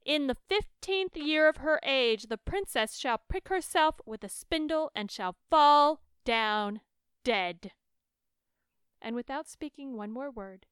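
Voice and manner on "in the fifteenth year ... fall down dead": booming curse, shouted out; loud cry. A narrator's reading. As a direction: roar the curse at the court. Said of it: "she cried with a loud voice".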